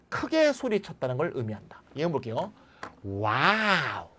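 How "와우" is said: long, drawn-out wow of joy; delighted wow. Shown as a demonstration. Teacher demonstrating an exclamation of joy, drawn out. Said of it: "격한 감정 보이죠?".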